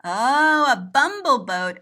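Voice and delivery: plain reading voice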